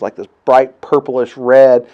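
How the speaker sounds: ordinary talk, nothing marked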